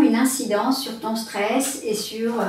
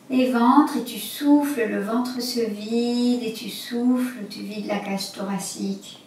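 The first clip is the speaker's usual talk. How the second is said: guided breathing. Acted.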